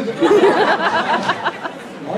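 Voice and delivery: high, loud laughing burst; real laughter. Real, not acted. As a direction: crack up at yourself. Laughing at own plan.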